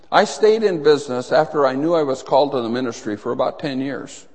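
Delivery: ordinary talk, nothing marked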